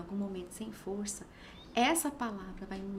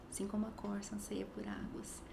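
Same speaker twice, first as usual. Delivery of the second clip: soft, understated prayerful plea; retold plea; 7 dB quieter than usual. Tired mother praying to the Lord in crying spells, quiet plea. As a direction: murmur it like a private cry for help. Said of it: "Então, era um clamor mesmo".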